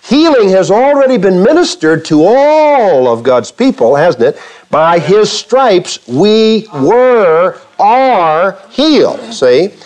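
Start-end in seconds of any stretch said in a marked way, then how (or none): 4.7-9.2 slow scripture quote. Real, not acted. Quoting scripture to the congregation.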